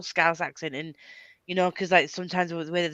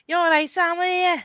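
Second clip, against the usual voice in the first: high, singsong Scouse phone greeting; mock Scouse accent; 12 semitones higher, 4 dB louder than usual. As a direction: put on a hammed-up, high-pitched Scouse. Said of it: "every time she's on the phone, I'm like".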